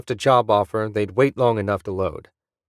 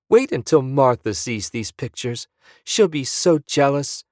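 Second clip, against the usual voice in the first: put-on excited old wife; 4 semitones higher than usual. Tourist wife gushing again.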